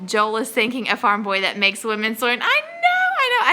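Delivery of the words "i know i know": very high, gushing I know; giddy squeal. Real, not acted. Host gushing to her co-host about Joel, a very high giddy squeal. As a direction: squeal agreement like a swooning fan. Gushing over handsome farmer.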